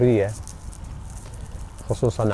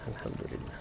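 whispered; 9 dB quieter than usual. Real, not acted. Repeated thanks, fading to whisper.

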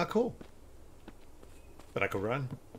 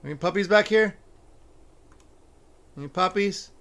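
loud, high call into the room; 8 semitones higher, 9 dB louder than usual. Real, not acted. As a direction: call out for the puppies. Calling out for the puppies.

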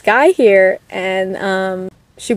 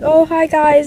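delighted greeting; 7 semitones higher, slower than usual. Retold or imitated. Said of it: "And I was like".

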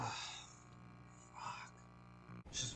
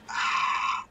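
loud burst of alarm; startled scream; 9 dB louder than usual. Real, not acted. Streamer startled by the game, loud scream. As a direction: scream in fright.

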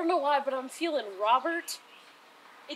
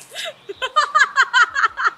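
high shrieking laughter; 11 semitones higher, 11 dB louder than usual. Real, not acted. Drenched with cold water.

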